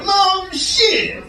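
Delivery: high, drawn-out exclamation; exaggerated high-pitched mock voice. Comic showing a weird way to talk, high hammed-up caricature. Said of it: "It's weird to go".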